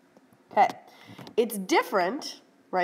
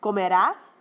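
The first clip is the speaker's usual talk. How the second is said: Comerás with the final -rás hit louder; stress on the last syllable; 4 dB louder than usual. Shown as a demonstration. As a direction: land the accent on -rás of Comerás. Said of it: "It's gotta be where that accent falls".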